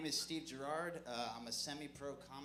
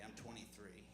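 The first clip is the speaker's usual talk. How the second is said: ordinary delivery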